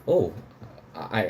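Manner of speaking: ordinary talk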